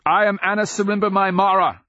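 high, loud and fast proclamation; crying out. Acted. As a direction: shout her name to the demons. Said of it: "she cries".

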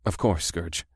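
soft and low. Acted. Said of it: "in a low voice".

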